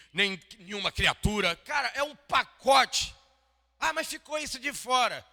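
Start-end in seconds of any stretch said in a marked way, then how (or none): 1.6-5.2 high, loud excited exclamation; fervent preacher, pitch way up; excited preaching. Real, not acted. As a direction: marvel at it out loud.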